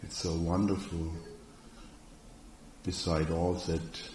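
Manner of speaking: soft, unhurried trance-induction voice; guided meditation. Real, not acted. Hypnotherapist guiding a seated group into inner orientation, soft guided meditation. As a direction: ease them inward softly, leaving long pauses. Leads a guided relaxation exercise.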